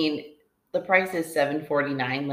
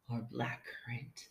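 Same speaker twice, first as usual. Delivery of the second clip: sultry reading; 5 semitones lower, 12 dB quieter than usual. Acted. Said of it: "You said it all weird".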